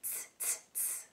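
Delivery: soft unvoiced tss; monkey tsk. A narrator's reading. Monkeys mocking the peddler, soft unvoiced tss. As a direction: hiss it back like a cheeky monkey. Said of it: "the monkeys ... said".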